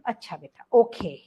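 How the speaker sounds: low, hushed parental reply. Shown as a demonstration. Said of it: "pitch को genuinely, strategically कम कर दीजिए".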